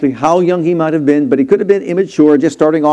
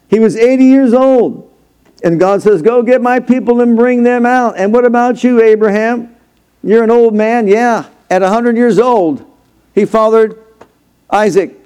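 high-pitched, ringing sermon delivery; animated preacher working the congregation; raised preaching; 7 semitones higher than usual. Real, not acted. Preacher raised on Moses and Abraham.

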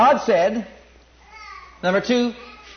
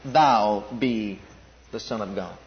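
very slow, much lower, dropping to near silence; sneering Satan; 9 semitones lower, 4 dB quieter, slower than usual. Retold or imitated. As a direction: drag it out low and sly like the devil. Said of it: "this maniac comes along and says".

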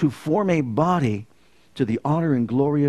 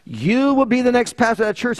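high, raised imitation of a voice; loud voice; 7 semitones higher than usual. Startled driver recalls the voice he heard, imitating it high and loud. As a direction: repeat the startling announcement, pitched well up. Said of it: "That was so loud".

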